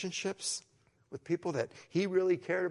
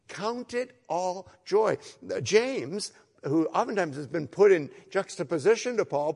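loud, rising sermon delivery, pounding each phrase; full-force preaching; 4 semitones higher, 11 dB louder than usual. Acted. Pastor urging the congregation to count it all joy, loud preaching. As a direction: proclaim it to the whole congregation.

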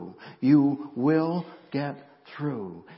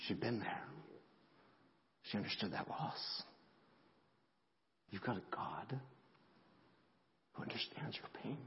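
fighting back tears, softer and softer, to a whisper; barely holding it together; a tearful near-whisper; choked up; 3 semitones lower, 17 dB quieter than usual. Real, not acted. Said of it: "I'm so emotional today".

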